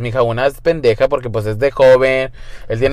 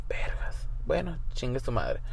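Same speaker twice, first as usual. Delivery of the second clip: hushed curse; 16 dB quieter than usual. Retold or imitated. Inner reaction, hushed.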